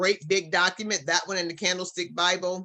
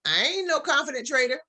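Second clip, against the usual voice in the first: higher, protesting voice of a doubting newbie; doubtful student; 7 semitones higher than usual. Pretend. Student objecting to the coach's affirmations, protesting. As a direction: push back like an unsure beginner. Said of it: "Now, you may be saying".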